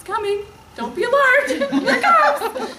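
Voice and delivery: high, loud mock alarm through giggles; laughing warning. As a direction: announce the coming tears in a laughing, high voice. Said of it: "I have to preface, like".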